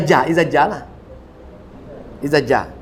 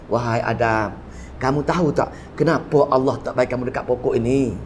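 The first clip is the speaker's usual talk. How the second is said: a hint of the tempter coaxing; lightly voiced Iblis; 7 semitones lower than usual. Preacher voicing Iblis questioning Adam, lower and coaxing. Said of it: "iblis kata".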